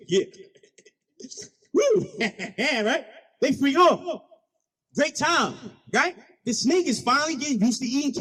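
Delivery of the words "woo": high "woo"; whoop. Real, not acted. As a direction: let out a high-pitched whoop. Whoops during the party scene.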